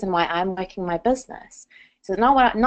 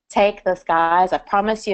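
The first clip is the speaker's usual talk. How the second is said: ordinary talk, nothing marked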